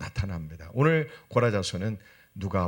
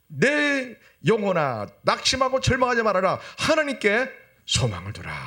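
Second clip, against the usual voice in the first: impassioned exhortation; 11 semitones higher, 5 dB louder than usual. Acted. Psalmist urges his soul to hope in God, impassioned exhortation. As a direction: call out the verse on a raised pitch.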